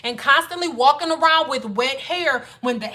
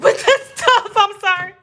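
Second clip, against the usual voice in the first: laughing while irritated; 7 semitones higher, 4 dB louder than usual. Real, not acted. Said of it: "I'm getting irritated".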